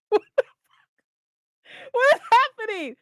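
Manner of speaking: breathless unvoiced gasp of disbelief rising to a high squeal; stunned disbelief. Reacting to a sudden flashback, whispered shock turning into a high squeal. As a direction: play the utter shock of a reader lost in the plot. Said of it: "And I'm like".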